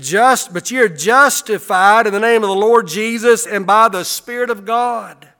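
loud, high preaching of the verse; preached scripture. Acted. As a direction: proclaim the verse to the room.

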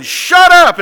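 high, very loud shout; shouted order. Retold or imitated. Crowd to the blind man, shouting him down.

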